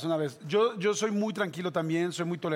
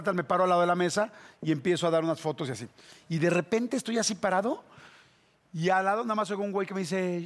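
normal talking voice

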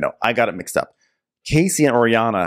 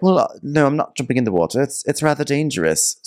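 prim mock voice; 6 semitones higher than usual. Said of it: "Lewis is like".

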